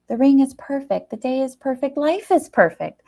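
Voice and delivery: high, gushing happy lilt; giddy joy. Acted. Eleanor twirling, giddy with joy over the ring. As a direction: sing it out in giddy delight.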